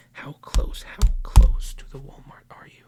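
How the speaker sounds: loud, urgent whispered demand; whisper shout. Acted. Mock cop demanding the suspect's address, loud whisper. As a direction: push the whisper hard like pressing a suspect.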